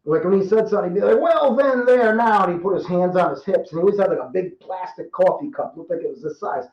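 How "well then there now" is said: a higher-pitched take on the major's voice; imitating his old major. Storyteller imitating his former major's way of talking, pitched up. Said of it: "I remember he talked like this".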